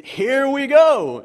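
high, loud, slow drawn-out cheer; party cheer. Retold or imitated. Pilot in beer commercial cheering at beer cart, drawn-out party cheer. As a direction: stretch it out like a party is starting. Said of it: "I mean".

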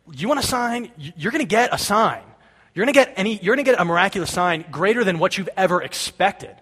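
animated, high-pitched retort; defiant promise. Acted. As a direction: throw the challenge back at them. Acting out Jesus answering the challenge.

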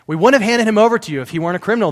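indignant retort. Acted. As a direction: fire it back at Pilate like an angry mob. Re-reading the accusers' retort dramatized.

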